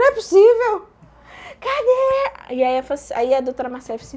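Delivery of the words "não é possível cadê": high, loud cry of disbelief; re-enacted high-pitched wail; retold outburst. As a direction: cry out in exasperated mock despair. Re-enacts outburst at missing anesthesia.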